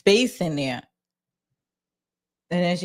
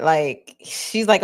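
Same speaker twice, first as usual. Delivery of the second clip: ordinary delivery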